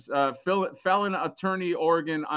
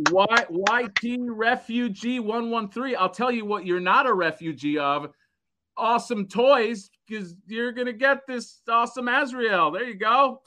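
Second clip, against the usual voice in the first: higher, drawn-out game-show style reveal; prize announcer; 6 semitones higher than usual. Acted. Host telling the winner he gets Azrael, showy announcer. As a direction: stretch the winner's name and prize.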